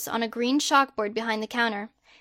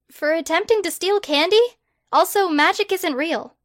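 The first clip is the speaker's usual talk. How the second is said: higher, exasperated protest; indignant girl; 6 semitones higher, 3 dB louder than usual. Acted. Hermione protesting her arrest to Harry, higher and indignant. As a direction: push back in disbelief.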